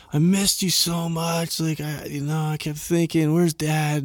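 put-on a little kid missing dad, pleading softly; sweet kid voice. A kid greeting dad home after a trip, soft and slow.

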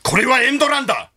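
high, loud dramatic exclamation; 渡辺謙. Retold or imitated. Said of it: "大きい声で言ってましたよ". (興奮した観戦客の大声の物真似)

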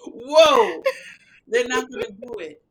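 loud whoa breaking into laughter; laughing. Retold or imitated. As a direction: laugh through the words. Laughing recap.

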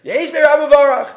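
high, quick called-out quote; mock scream. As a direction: call it out high and fast, imitating an outcry. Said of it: "What's he screaming out Yehei Shmei Rabba for?".

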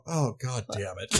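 quiet defeated grumble at the phone; low muttered curse. Retold or imitated. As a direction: mutter it under your breath, defeated. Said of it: "For once, I'm Travis".

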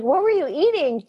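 mock cross-examining; playful grilling. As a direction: press for an answer, half in fun. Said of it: "I just started grilling her".

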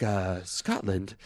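put-on soft, slow Belgian-French accent; Christopher Lambert impression. Podcaster mocking the casting to co-hosts, Belgian-French accent. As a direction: murmur it in a French-tinged accent. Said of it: "he's speaking with his, like, Belgian, like, French ex-, accent".

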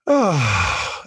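long falling "ah" of relief; sigh of relief. Acted. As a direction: let out a big relieved sigh. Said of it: "there's this huge relief".

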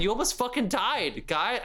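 ordinary talk

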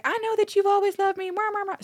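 high, breathy-free swoon then soft kisses; mock swooning mom. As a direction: play a lovestruck old mom with kissy noises. Mom voiced kissing Uncle Jerry.

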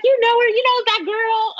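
surprised question. Retold or imitated. Colleague recalls Organic asking if she knows Ava, surprised and high. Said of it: "He's like".

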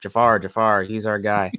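half-hearted, deadpan cheer; sarcastic cheer. Retold or imitated. Genie waving small flag for Jafar, sarcastic mock cheer. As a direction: cheer with no enthusiasm at all. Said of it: "pulls out this sarcastic, like, small flag".

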